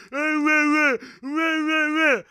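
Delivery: high, taunting "wah wah"; hammed-up mock bawling; mock crying. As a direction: fake baby wailing to tease complainers. Mock crying at complaining viewers.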